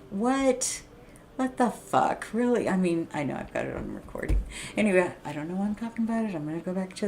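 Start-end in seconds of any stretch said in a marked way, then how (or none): 0.1-2.7 incredulous. Retold or imitated. Retelling disbelief at cop.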